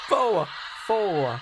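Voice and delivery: learning to mimic Harris's accent from the clip; Southern "four" imitation. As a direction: copy her Southern twang eagerly. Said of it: "She's teaching me how to do it".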